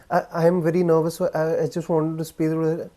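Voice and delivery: nervous, stumbling rush; speaking too fast. Shown as a demonstration. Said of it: "Or speaking too fast".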